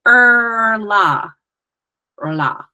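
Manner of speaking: "rla" loud, rolled R, with an L after; exaggerated rolled R. Shown as a demonstration. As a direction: roll "rla" hard and clear. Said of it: "that exaggerated".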